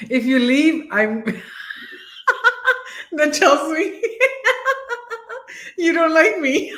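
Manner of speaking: high, giggly teasing through real laughter; laughing. Real, not acted. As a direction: crack up while teasing. Joking with co-host, laughing throughout.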